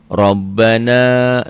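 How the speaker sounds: Rabbana with a heavy, thick ro, drawn out slowly; Arabic example word. Shown as a demonstration. Teacher demonstrating Rabbana to students, slow and heavy. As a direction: say Rabbana slowly with a full tafkhim ro. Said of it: "nih ro-nya baris di atas".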